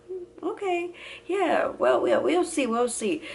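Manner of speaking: high, lightly singsong knowing nurse; smiling nurse. Retold or imitated. Nurse humoring a patient in denial, knowing. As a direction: humor the patient with a small smile. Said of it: "like, smiling, like, not a big smile".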